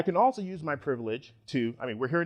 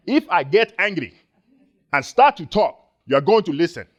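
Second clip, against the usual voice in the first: much louder and higher, a forceful booming demand; command presence; 4 semitones higher, 9 dB louder than usual. Shown as a demonstration. Speaker showing the audience his angry voice, booming command. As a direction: fill the room so nobody dares interrupt. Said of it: "that's a thing called command presence".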